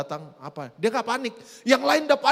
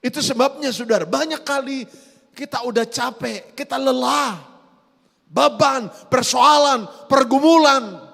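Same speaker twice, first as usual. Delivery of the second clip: full-force preaching; 8 semitones higher, 9 dB louder than usual. Acted. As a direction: thunder it out over the congregation.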